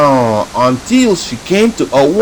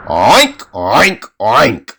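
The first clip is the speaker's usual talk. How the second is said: pig oinks; 4 semitones lower, much slower than usual. Acted. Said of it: "the pig said".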